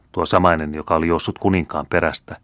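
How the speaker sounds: plain reading voice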